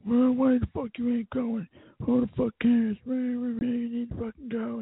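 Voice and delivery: high, whiny mock of angry friends; mocking pissed-off friends. Mocking the angry friends who demand she come to the party, high and whiny. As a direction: squeak it out like annoyed nagging friends. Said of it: "they got really pissed off and mad".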